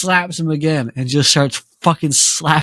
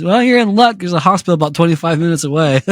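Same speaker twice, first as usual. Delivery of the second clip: higher, quick mock cheerful quip; mock cheerful taunt; 4 semitones higher, 3 dB louder, faster than usual. Mocking the thug after breaking his fingers, higher and chirpy. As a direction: chirp it brightly, rubbing it in.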